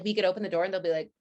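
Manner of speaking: ordinary delivery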